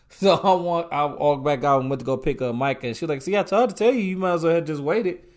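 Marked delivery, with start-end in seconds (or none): 3.2-5.2 louder, higher quoted scolding; acted-out chiding, raised voice; mock I-told-you-so. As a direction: imitate a wife saying I told you so, pitched up.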